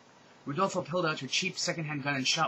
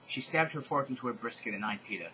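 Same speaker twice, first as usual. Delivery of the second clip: ordinary delivery, nothing marked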